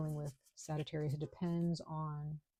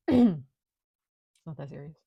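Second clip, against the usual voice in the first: much louder, drawn out, stress on not; loud, emphatic, slow reassurance; loud, slow dismissal; 12 dB louder, much slower than usual. Shown as a demonstration. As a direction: boom it out slowly, not a big deal. Repeating the line after throat clear.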